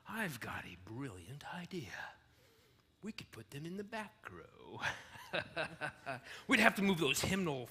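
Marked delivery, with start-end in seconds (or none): 0.1-4.8 scheming whisper. Acted. Scheming aside.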